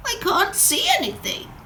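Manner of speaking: a tiny drop, suddenly puzzled and alarmed; Wendy's voice. Acted. Storyteller voicing the water drop for children, high and puzzled.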